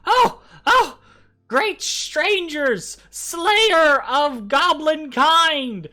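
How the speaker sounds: very high, loud, slow goblin cries of thanks; overjoyed captive goblin shrieking; shrieking grateful goblin. Acted. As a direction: wail your gratitude like a desperate little goblin. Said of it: "the goblin is just like".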